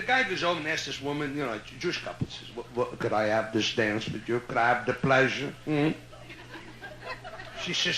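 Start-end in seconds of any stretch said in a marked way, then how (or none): 2.6-5.9 put-on low, courtly Yiddish-accented old gent; a lightly voiced Yiddish-accented suitor asking for a dance; Yiddish-accented old man. Old man asks a woman to dance, light Yiddish accent.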